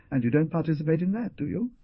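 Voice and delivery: quick, sharp challenge; a pointed, fast retort; sharply. A narrator's reading. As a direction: snap the question back fast. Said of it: "asked the young man sharply".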